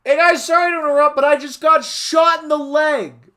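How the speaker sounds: very high, loud and fast yell; screaming Ryuji voice. Acted. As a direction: shriek it out in a panic. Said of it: "Ryuji yelled".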